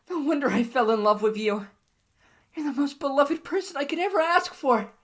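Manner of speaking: put-on overjoyed girlfriend answering a marriage proposal; ecstatic. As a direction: squeal with delight at the ring.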